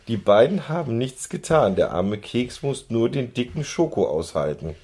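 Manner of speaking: ordinary delivery, nothing marked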